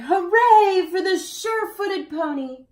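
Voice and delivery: high, loud cheer. A narrator's reading. Lovely ladies cheer the pony at the city, high and loud. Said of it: "The lovely ladies cried".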